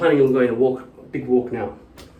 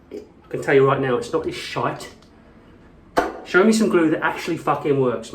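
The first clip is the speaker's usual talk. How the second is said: usual speaking voice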